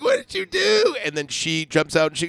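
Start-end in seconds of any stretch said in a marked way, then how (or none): 0.0-0.9 very high imitated cry of dismay; mock panic. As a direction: squeal the question as the panicked boyfriend. Retells boyfriend's panicked question.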